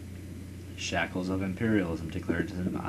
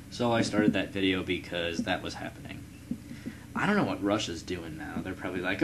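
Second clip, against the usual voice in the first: normal talking voice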